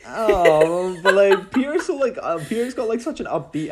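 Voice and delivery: high excited gush; excited outburst. Real, not acted. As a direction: burst in with enthusiasm. Excited outburst about accent.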